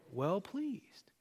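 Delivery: breathy whisper trailing off. Acted. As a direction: hush it to a whisper as God speaks. God's voice spoken softly.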